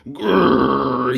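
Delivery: mock growling grrr; troll growl. Grumpy troll growling at the party visitor. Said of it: "he bellowed".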